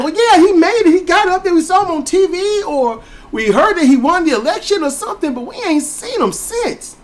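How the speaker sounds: high, gossiping relative; an aunt talking about the one who left; family voice. Retold or imitated.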